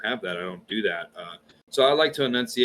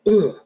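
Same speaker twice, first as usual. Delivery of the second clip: loud, high "ugh"; sudden loud groan of recoil; acted groan; 10 semitones higher, 9 dB louder than usual. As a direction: groan it out in mock dismay. Reacts to hearing his Southernness.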